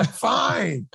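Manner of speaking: high, laughing consent; over-eager agreement. Retold or imitated. Actor telling director any haircut is fine, giddy eagerness. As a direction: give in gleefully, pitch leaping up.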